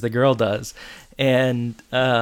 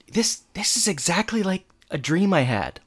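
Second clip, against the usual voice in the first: higher-pitched mock amazement; amazed realization; 6 semitones higher than usual. [name] recognizing his dream, amazed. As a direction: lift the pitch as it dawns on you. [name]'s first amazed claim, then repeated.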